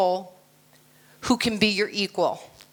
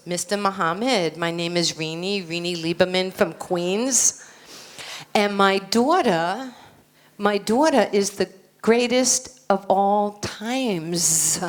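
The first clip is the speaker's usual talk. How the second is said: put-on Queens accent, vowels stretched; 2 semitones lower than usual. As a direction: boast about your kid in thick New York accent. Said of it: "who talks like this from New York".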